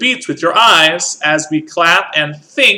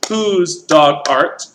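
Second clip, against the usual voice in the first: spoken slowly; much slower than usual. Shown as a demonstration. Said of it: "rhythm means how the word sounds".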